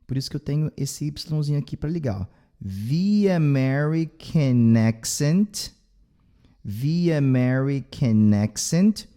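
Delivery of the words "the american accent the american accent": "The American" linked slowly, "the" running into "American"; slow linking demo. Shown as a demonstration. Teacher slowly shows students the linked "the". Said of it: "ele tem o som de V".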